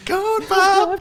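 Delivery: very high, loud squeal; squealed name. Pretend. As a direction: shriek the name with glee.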